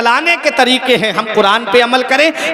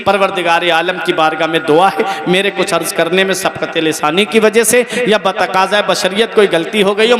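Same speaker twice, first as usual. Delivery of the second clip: usual speaking voice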